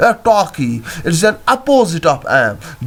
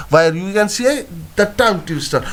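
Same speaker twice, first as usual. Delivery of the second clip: ordinary talk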